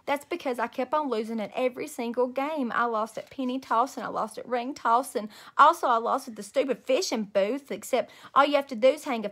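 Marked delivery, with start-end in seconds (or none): none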